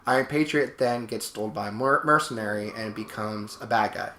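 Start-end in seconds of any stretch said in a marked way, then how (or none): none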